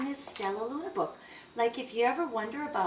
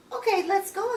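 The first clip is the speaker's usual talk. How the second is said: high, chirpy little bird voice; eager agreement; 11 semitones higher, 4 dB louder than usual. A narrator's reading. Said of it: "agreed Pip".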